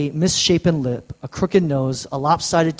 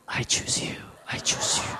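breathy, hissed mock-creepy whisper; hammed-up stalker whisper, played for laughs; 10 dB quieter than usual. Guy whispering to girls, a mock creepy whisper. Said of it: "let's not do it in a creepy way".

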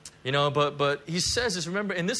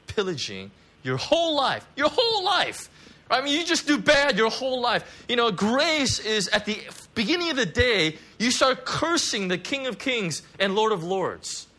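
higher, louder building climax; preacher's shout; 8 semitones higher, 6 dB louder than usual. Acted. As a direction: hammer the sinner's whole life.

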